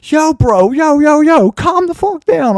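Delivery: high-pitched, drawn-out pleading to back off; urgent retold plea. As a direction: re-enact talking a man down, voice way up. Retold plea to calm a fight.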